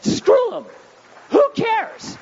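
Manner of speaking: "Screw 'em" shouted high and loud. Shown as a demonstration. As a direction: bellow it at the whole congregation. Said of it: "Let me say that again".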